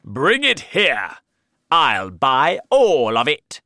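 slow, loud, eager yell; a greedy king grabbing for cloth; greedy shout. Acted. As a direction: demand it at the top of your voice. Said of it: "The king shouted".

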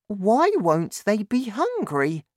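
put-on high-pitched child's voice; puzzled boy questioning his dad; young son. A narrator's reading. Narrator voices young son George.

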